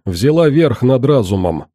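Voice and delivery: ordinary delivery, nothing marked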